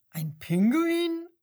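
high, loud, slow disbelieving question; astonished. A narrator's reading. Lion baffled to meet a penguin in the zoo, astonished. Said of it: "fragt der Löwe verblüfft".

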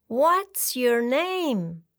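slow, friendly princess question. A narrator's reading. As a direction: ask kindly, very slowly. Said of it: "fragt sie freundlich".

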